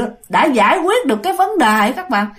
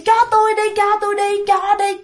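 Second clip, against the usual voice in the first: high singsong begging; pleading ghost woman; 9 semitones higher than usual. Acted. Ghost woman begs mother for her baby, sweet pleading. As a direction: keep begging on one high, even note. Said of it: "mà bà nói vậy nè".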